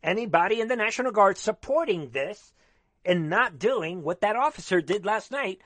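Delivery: usual speaking voice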